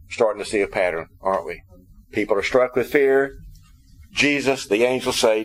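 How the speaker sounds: ordinary talk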